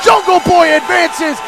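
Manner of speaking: loud, high, slow big-finish call; excited shout. Real, not acted. Calling the match-winning finisher.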